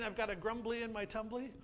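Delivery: usual speaking voice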